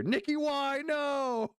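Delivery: high, hammed-up panicked handler yell; mock screaming handler. Streamer voicing handler yelling at Nicki, high mock panic. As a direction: play the frantic handler in her ear. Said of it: "The handler is screaming in her ear".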